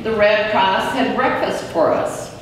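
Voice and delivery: plain reading voice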